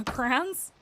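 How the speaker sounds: "crayons" squashed to "crans"; questioning one-syllable "crans" for "crayons"; others' pronunciation. Retold or imitated. Trying out an example, squashed "crans". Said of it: "a lot of people say".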